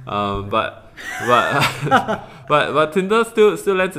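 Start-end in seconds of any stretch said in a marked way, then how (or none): 1.3-4.0 laughing. Real, not acted. Laughing about low quality.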